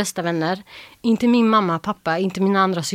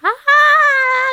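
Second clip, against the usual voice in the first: long high-pitched loud scream; 7 dB louder than usual. Retold or imitated. Reliving the phone call from TV, screaming. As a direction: let out a piercing shriek of excitement. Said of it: "jag började skrika och skrika".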